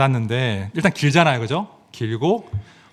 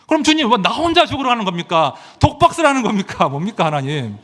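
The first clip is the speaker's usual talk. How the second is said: hammed-up protest to God; mock indignant complaint; 7 semitones higher than usual. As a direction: pastor acting out his irritated complaint to God. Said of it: "너무 성질이 나는 거예요".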